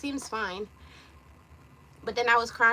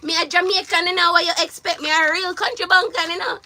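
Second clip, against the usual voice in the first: higher, louder Jamaican patois; 6 semitones higher, 5 dB louder than usual. Retold or imitated. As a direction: lay the patois on thick to his mother. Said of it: "Me say".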